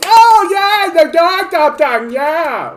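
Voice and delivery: very high, loud squeaky cheering voice, slow; mock cheer. Streamer mocks Canadian people cheering a tiny policy, squeaky high cheer. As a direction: shriek with dumb delight.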